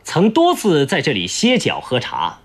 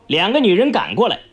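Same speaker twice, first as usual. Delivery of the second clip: ordinary delivery, nothing marked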